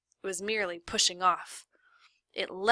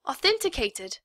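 outraged disbelief; 7 semitones higher, 6 dB louder than usual. Acted. Lawyer outraged at excuse.

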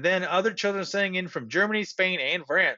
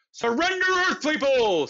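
commanding alien radio; 8 semitones higher, 4 dB louder than usual. A narrator's reading. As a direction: proclaim the demand to captives. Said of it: "said the radio".

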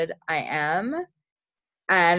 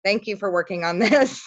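usual speaking voice

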